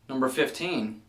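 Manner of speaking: ordinary talk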